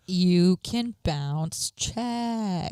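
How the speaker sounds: very slow, drawn-out mock voice; slow drawl. Joking about lying in the house, very slow. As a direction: stretch every word out. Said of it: "joking Big Brother mantra".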